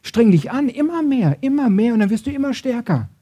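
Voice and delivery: high, pushing coach-like urging; driving exhortation. Acted. The Law drives us to try ever harder, urgent exhortation. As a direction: keep pressing them to work harder. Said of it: "Das Gesetz sagt".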